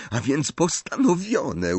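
high, drawn-out, delighted conclusion; pleased. A narrator's reading. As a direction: settle it happily, pitch lifted. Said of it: "ucieszyła się Hermiona". (z radością, wysoko i przeciągle)

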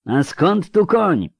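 slow, high, loud call; shouted question. A narrator's reading. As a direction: stretch the question out over the storm. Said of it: "Kahir też musiał krzyczeć". (przeciągłe pytanie krzykiem)

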